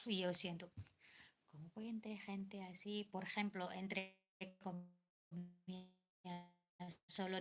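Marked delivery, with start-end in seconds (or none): none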